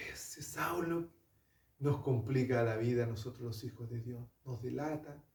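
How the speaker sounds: hushed, secretive whisper; whispered gossip. Acted. Believers whispering fearfully about Saulo, hushed. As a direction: whisper it like gossip behind his back.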